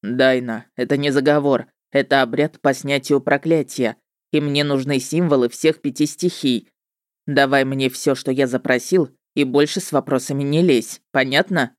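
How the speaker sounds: строгий мужской голос ведьмака; объясни с нажимом, как ведьмак. A narrator's reading. (строгий ведьмак)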